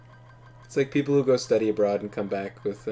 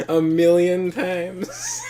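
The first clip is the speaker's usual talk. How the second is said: laughing; 7 semitones higher, 5 dB louder, much slower than usual. Real, not acted. Laughing at chat's joke.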